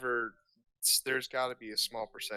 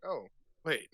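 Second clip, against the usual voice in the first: sudden realization; 6 semitones higher than usual. Acted. Aliens catching on, acted realization.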